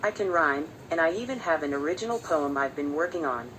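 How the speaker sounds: ordinary talk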